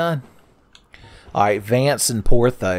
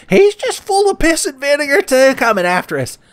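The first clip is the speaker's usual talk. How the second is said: put-on high-pitched kid voice; 7 dB louder than usual. Praising feisty crab.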